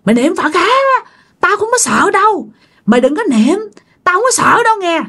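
high, eerie scornful taunt; mocking ghost. Ghost woman taunting the praying dreamer, high eerie scorn. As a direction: sneer at the prayers like a fearless ghost. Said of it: "bà chửi bạn".

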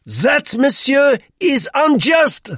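very high, loud, indignant; bristling. A narrator's reading. Duclos objects to Roux's taunt, bristling. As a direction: bristle and protest slowly at full voice. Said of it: "Monsieur Duclos bristled".

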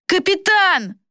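high, drawn-out call from behind. A narrator's reading. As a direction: cry out for the captain in a boy's high voice. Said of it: "раздалось позади". (высокий протяжный окрик)

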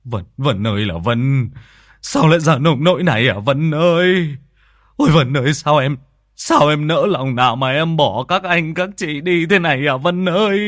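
two men wailing over their sister's corpse; wailing lament. Acted. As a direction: wail her name in grief. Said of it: "đang gào khóc to".

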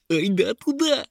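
high, eager creature yell; foolish imp shouting. A narrator's reading. Silly imp urges others off the path, high eager shout. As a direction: call out giddily, pitched high. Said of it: "крикнул один глупец".

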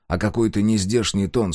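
ordinary delivery, nothing marked

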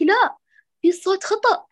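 a mock warning no; the ear raising a high alarm; inner voice objecting. Ear scolding the reader, high and warning. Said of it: "تشعري أذنك كذا تقول لك".

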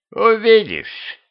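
high, stretched-out single word; cryptic skeleton. Acted. Skeleton answers who its folk are, cryptic drawl. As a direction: draw it out mysteriously as a skeleton.

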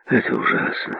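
sad. A narrator's reading. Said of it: "печально покачал головой судья".

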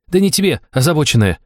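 snapping. Acted. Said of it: "огрызнулась".

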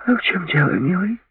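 high, coaxing questioning voice; seductive surprise. A narrator's reading. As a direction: ask it sweetly puzzled. Lori asks, surprised.

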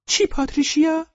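extremely high, loud falsetto; very high put-on mother voice; mother character. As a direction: answer the daughter in a squeaky mom voice. Mother answers daughter in squeaky exchange.

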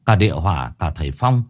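ordinary delivery

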